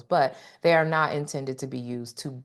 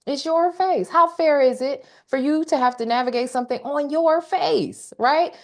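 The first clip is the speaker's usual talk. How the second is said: impassioned high plea; 10 semitones higher than usual. Real, not acted. Emphatic rising plea.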